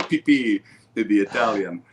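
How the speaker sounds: a hint of an Italian accent. Shown as a demonstration. Showing the puppet chef's voice, mock Italian accent. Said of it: "trying to give him an Italian accent".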